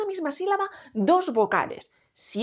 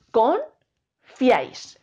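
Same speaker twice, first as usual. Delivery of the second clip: "Confiáis" split as Con... fiáis; very slow two-beat "Confiáis"; syllable by syllable; much slower than usual. Shown as a demonstration. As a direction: pause between "Con" and "fiáis", drawing each out. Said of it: "Separamos esta palabra en sílabas".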